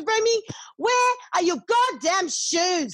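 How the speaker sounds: put-on high, slow shout at the kid; passionate loving yell. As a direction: yell for the missing shoes, half fond. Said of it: "It's a passionate yell. It's a loving yell".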